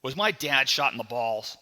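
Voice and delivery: loud, sudden question; blurted out. Acted. Boy bursts in to ask the maid about dad, blurting loudly. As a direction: blurt it out at full volume. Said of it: "I blurted".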